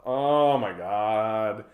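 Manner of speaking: put-on slow, drawn-out Valley Girl groan; hammed-up weary annoyance; eye-rolling exasperation. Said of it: "rolling her eyes and just being like".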